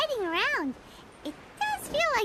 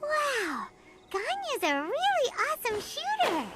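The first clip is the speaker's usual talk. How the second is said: excited; 6 semitones higher than usual. Acted. Watching Ganyu shoot.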